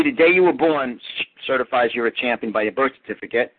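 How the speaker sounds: usual speaking voice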